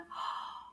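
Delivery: soft theatrical intake of breath; mock surprised gasp. Teacher pretends Anana has just appeared, mock surprised gasp. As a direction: gasp as if delighted.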